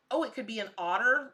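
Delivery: ordinary talk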